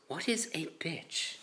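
slow, soft, puzzled quote; imitating baffled game creator. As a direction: act out a hushed, bewildered question. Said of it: "And then he goes".

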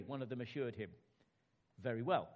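storyteller voice for a bedtime tale; children's story narration. Acted. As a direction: read it like sitting kids down for a story. Reads children's story aloud.

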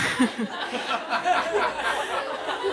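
long high-pitched laughing fit; helpless giggles; high giggling fit. Real, not acted. Narrator losing it mid-story, giggling fit.

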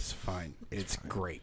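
low, soft, tight-lipped reassurance; mock post-fight denial. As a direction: imitate a partner clearly not fine. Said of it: "walking in the room after a fight".

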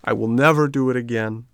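mildly tearful promise; plaintive puppet vowing to be good; crying. A narrator's reading. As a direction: promise with a touch of weeping.

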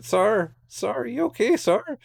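put-on high-pitched, questioning girl's voice; mock-anxious high-pitched calling; imitated concerned girl. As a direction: check on a hurt stranger in a high voice. Acts out girl checking injured man.

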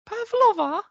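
very high, questioning fake Australian accent; failed Australian accent. Shown as a demonstration. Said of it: "that's not Australian".

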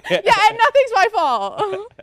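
high, loud, drawn-out joking denial; mock innocence. Joking to friend about never being at fault, mock innocence. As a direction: stretch it out slowly in playful self-absolution.

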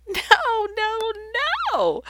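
high, drawn-out wail of despair; mock exasperated wail. Jesus answering his disciples' questions, hammed-up exasperated wail. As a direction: wail each no in hammed-up frustration. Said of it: "And Jesus is going".

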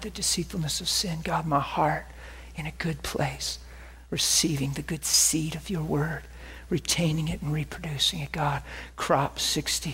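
soft, hushed prayer sinking to a whisper; quiet prayer. Acted. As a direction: pray it low and close. Prays quietly for a soft heart.

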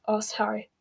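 ordinary delivery, nothing marked

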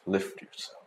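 breathy whisper to himself; whispered self pep talk. Shown as a demonstration. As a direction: murmur it like a secret mantra.

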